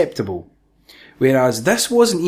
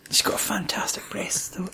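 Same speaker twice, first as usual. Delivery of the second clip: hushed whisper, much softer; whispered aside; 5 semitones higher, 11 dB quieter than usual. Real, not acted.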